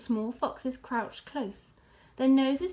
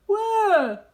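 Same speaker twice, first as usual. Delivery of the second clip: badger asking; 8 semitones higher, 9 dB louder than usual. A narrator's reading. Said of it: "asked Badger".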